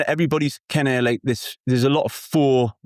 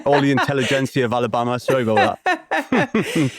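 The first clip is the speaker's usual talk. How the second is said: apologising through laughter; giggling sorry; laughing; 3 semitones higher than usual. Real, not acted.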